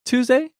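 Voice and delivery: Tuesday pitched high, rising at the end; rising question intonation. Shown as a demonstration. Teacher translating a guess at the day, Tuesday with rising intonation. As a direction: ask Tuesday as a one-word question. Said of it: "Rising intonation, question".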